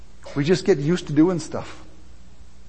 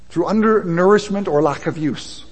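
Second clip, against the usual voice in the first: normal talking voice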